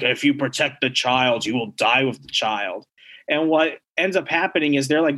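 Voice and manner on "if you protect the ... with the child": lower, menacing possessed voice; dark demonic voice. Acted. Possessed Human Torch threatening Thing and Franklin, demonic voice. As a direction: growl it like a demon inside Johnny. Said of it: "His voice is all dark, evil, and demonic".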